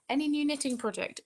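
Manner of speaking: high, loud question read-out. Real, not acted.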